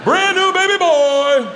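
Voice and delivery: high, drawn-out public holler; loud as fuck. Retold or imitated. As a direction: bellow it slowly to the whole parade. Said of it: "loud as fuck".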